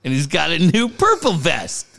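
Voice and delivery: ordinary talk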